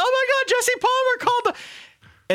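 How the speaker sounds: very high-pitched starstruck squeal; teenage fangirl excitement. Retold or imitated. Co-host re-enacting his reaction to the voicemail, high fangirl gushing. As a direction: gush like a swerving, starstruck teen fan, way up high. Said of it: "I sound like a fourteen-year-old One Direction fan".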